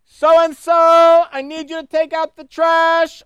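loud, high mom calling through the house; mother yelling up the stairs. Retold or imitated. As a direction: call it out like a mom down the hall. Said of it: "your mom calls through the house".

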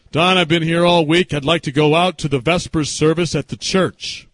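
loud, imitated grandfather; grandfather's request. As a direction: put on a loud grandfather asking to go out.

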